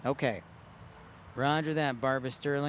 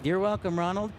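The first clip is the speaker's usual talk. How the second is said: normal talking voice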